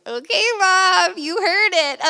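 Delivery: excited, high. Retold or imitated. Thrilled telling mom on phone.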